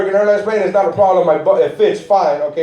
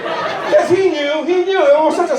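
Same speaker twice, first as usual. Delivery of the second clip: high squeaky emphasis; 10 semitones higher than usual. Real, not acted.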